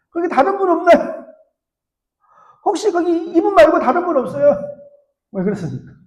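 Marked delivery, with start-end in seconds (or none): 0.1-4.5 put-on young man calling past God for another helper; shouting. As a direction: yell up for someone else. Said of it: "다시 이렇게 소리칩니다".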